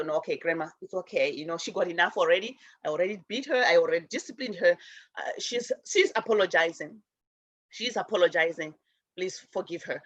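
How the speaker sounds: low, soft pleading for forgiveness; calm grandma down gently; soothing elder. Retold or imitated. Neighbor calming grandma down.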